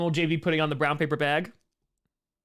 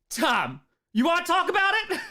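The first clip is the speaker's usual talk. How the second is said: imitating Scooter; 11 semitones higher, 6 dB louder than usual. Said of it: "He's be like".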